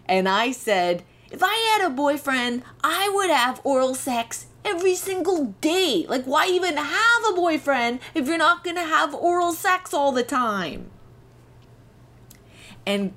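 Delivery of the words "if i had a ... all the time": put-on high, earnest kid voice, pitched well up; naive teen self. Telling health class girls she'd do it constantly, high and earnest. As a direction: play your clueless thirteen-year-old self, wide-eyed.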